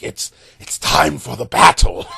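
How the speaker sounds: a hammed-up dark proclamation; mock epic villain. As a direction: announce the battle like an ancient evil. Mock old-timers welcoming a battle.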